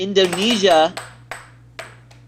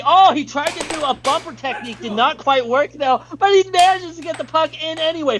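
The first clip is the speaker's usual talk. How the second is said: excited commentary; 9 semitones higher than usual. Real, not acted. Commentating a surprising save.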